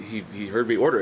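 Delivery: ordinary talk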